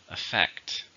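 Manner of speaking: "Affect" with the stress on its second syllable. Shown as a demonstration. Teacher showing learners the stress, "Affect" stressed on the second syllable. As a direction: say "Affect" leaning on "fect", the last syllable. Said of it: "stressed in the second".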